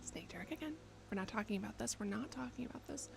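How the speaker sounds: very soft, under the breath; hushed aside. Real, not acted. Streamer brushing off a donor's name, hushed. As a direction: keep it down, barely voiced.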